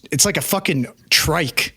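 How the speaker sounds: ordinary talk, nothing marked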